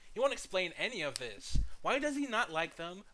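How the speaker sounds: high, loud rant; frustrated demand for answers; exasperated rant. Real, not acted. Ranting that the reviewer won't explain.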